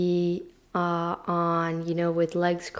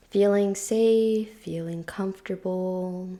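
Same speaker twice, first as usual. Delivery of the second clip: guided meditation; 3 dB quieter than usual. Real, not acted. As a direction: ease them gently into stillness, unhurried and hushed.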